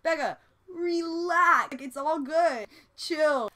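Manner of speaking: imitating friends telling her to chill; mock-soothing friend saying Becca, relax; calming friends. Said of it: "people are like".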